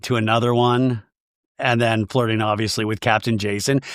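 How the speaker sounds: ordinary talk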